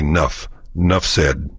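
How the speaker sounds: "'Nuff" said as "nuff", no e; clipped "'Nuff said"; dropped E. Shown as a demonstration. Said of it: "ditch the E in enough".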